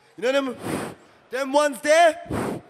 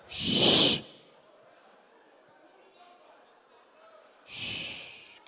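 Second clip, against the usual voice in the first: shushing; 9 semitones higher than usual. Real, not acted. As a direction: hush everyone down. Hushing a noisy crowd.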